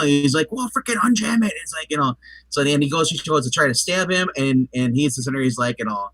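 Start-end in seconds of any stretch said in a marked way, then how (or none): 0.5-1.5 exasperated boss order. Retold or imitated. Retelling a movie scene dialogue.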